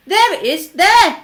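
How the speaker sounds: loud, high pointing shout. A narrator's reading. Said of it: "someone else shouted".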